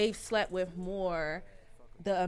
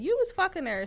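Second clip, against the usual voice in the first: indignant; 10 semitones higher, 6 dB louder than usual. Real, not acted. Calling out hypocrite men.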